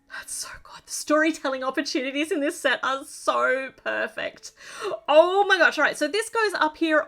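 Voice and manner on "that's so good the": hushed, breathless admiration of the model; awed whisper. Real, not acted. Excited builder whispering praise over her finished set. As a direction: whisper it like you're afraid to break the spell.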